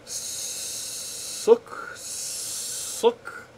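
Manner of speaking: Sook with a soft, long hissed S. Shown as a demonstration. Practising the Czech word Sook with a long soft S. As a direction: draw out the S in Sook softly. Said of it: "a softer S and longer".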